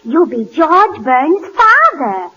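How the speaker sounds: ordinary talk, nothing marked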